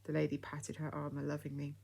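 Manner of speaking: plain reading voice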